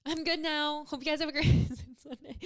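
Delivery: mock-chipper high, loud sign-off; over-bright pretend cheerfulness; joking fake cheer. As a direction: put on a hammed-up recovery, breaking into laughter. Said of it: "No, I'm just kidding".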